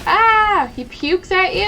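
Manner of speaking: high, loud shriek into a question; startled shriek. Real, not acted. Player reacts to an enemy puking at them, startled shriek. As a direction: cry out in alarmed disgust.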